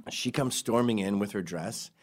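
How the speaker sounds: normal talking voice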